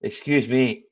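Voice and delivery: usual speaking voice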